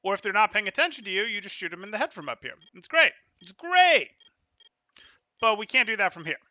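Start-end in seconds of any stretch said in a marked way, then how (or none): 2.7-4.1 much higher and louder, thrilled; excited exclaim. Real, not acted. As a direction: burst out high with real delight. Coach enthusing over the play.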